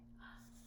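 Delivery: short unvoiced mock gasp. Reader gasping for the children as the lion charges. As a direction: gasp in pretend shock. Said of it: "reader gasps at the charge".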